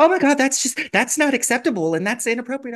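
high, loud outraged librarian; appalled. Retold or imitated. Librarian reacting to the man's proposition, appalled.

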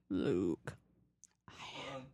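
low whispered Vader voice; Darth Vader voice. Retold or imitated. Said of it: "I sound like Darth Vader".

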